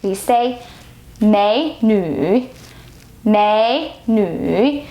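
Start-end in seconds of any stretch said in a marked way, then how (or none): 1.2-4.8 美女 with 美 rising and 女 dipping low; second then third tone. Shown as a demonstration. Teacher models beautiful girl, rising then low. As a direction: lift the first syllable of 美女, then sink on the second. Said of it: "second tone and third tone".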